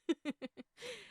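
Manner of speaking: a high, airy little laugh; sheepish laugh. Real, not acted. Admitting she hogs the floor, a sheepish laugh. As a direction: laugh it off lightly.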